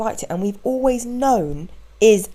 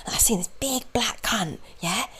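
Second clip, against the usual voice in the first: whispered, higher mock storyteller; hushed quote; 4 semitones higher, 7 dB quieter than usual. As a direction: whisper the ugly line as if overheard. Quoting the stranger's story.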